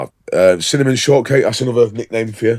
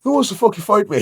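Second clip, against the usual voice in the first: high, taunting challenge; hammed-up pub brawler; mock Irish brawler; 11 semitones higher than usual. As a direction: pick a fight in a raised voice. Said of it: "The Irish came out".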